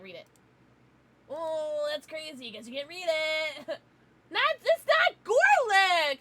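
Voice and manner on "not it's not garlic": much higher and louder, slow; loud high slow protest; mock indignant. Real, not acted. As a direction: protest it in playful outrage. Correcting chat, mock indignant.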